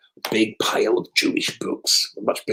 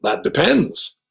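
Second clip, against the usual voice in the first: put-on American accent; 7 semitones higher, much slower than usual. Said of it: "in an American accent".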